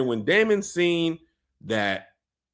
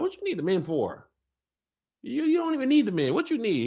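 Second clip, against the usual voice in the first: lady of the Vale; 10 semitones higher than usual. Retold or imitated. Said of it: "She was like".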